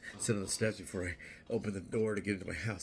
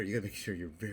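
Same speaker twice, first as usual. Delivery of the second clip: ordinary talk, nothing marked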